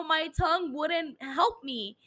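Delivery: ordinary delivery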